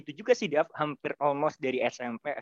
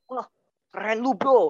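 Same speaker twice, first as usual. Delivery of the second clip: excited praise; 6 semitones higher, 3 dB louder than usual. Retold or imitated. Acting out friends' praise.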